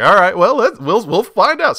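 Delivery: high, loud, playful self-quote; breezy mock reply. Acts out own reply to warning.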